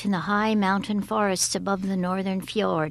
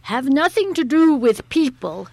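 higher, louder voice of an elder giant; loud, emphatic warning from an old giant; elder giant advising; 6 semitones higher, 8 dB louder than usual. A narrator's reading. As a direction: lay down firm advice as an elder. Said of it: "advised the elder giants".

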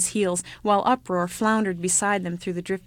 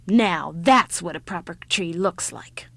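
slow, husky pride; satisfied old woman; 2 semitones lower, 4 dB louder than usual. Acted.

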